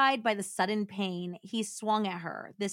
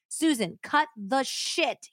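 higher, slow angry command; 6 semitones higher, slower than usual. Acted. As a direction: bark it, slowly. Mark shouting during the fight.

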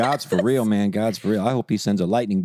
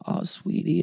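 tender; 9 semitones higher, 4 dB quieter, slower than usual. Retold or imitated. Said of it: "I was".

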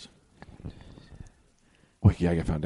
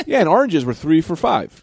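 ordinary talk

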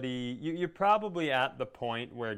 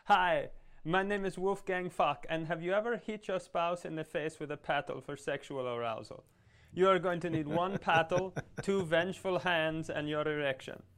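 mock Austrian TV-chef accent; singsong, exaggerated Austrian presenter voice; Wolfgang Fuck; 4 semitones higher than usual. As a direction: pitch it like an Austrian cooking-show host. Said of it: "you can't have that accent".

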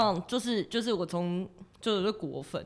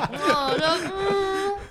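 flustered laughing; 11 semitones higher, 5 dB louder, much slower than usual. Real, not acted. Flustered after crude question.

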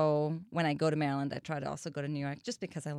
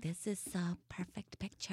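breathy, soft nagging inner voice; soft nagging inner voice, hushed; millennial entrepreneur voice; 9 dB quieter than usual. Retold or imitated. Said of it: "nagging voice like".

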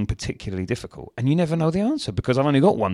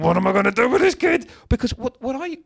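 much higher, despairing cry to the heavens; exasperated plea to the ceiling; 13 semitones higher, 3 dB louder than usual. Retold or imitated. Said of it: "looked at the ceiling and went".

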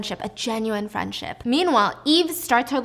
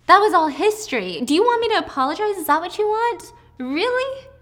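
much higher and louder, mocking; scornful outburst; 10 semitones higher, 6 dB louder than usual. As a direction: fling the apology back, incredulous. Eve's heated scornful reply.

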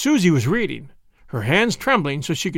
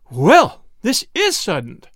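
loud first word, then higher; mother's flabbergasted outburst; astonished exclamation; 3 semitones higher, 5 dB louder, slower than usual. A narrator's reading. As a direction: exclaim it, taken aback. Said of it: "exclaimed Missus Roloff".

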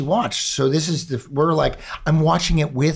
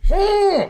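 high hesitant hmm; a wary high-pitched hum; doubtful hum; 4 dB louder than usual. Retold or imitated. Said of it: "And then I was like".

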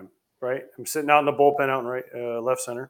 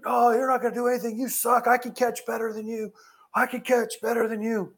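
high-pitched mock jeer; imitating a teenage heckler's taunts; taunting kid heckler; 9 semitones higher than usual. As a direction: goad him like a mouthy kid. Kid keeps taunting the catcher.

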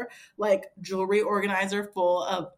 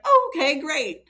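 loud, high-pitched retold reply; mock enthusiasm; 8 semitones higher, 8 dB louder than usual. Wife hearing he's coming home, mock enthusiasm. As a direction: burst out higher and louder, putting it on.

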